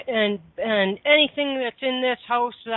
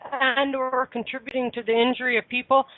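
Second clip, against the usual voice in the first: usual speaking voice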